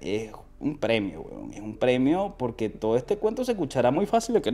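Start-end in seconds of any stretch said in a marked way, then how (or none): none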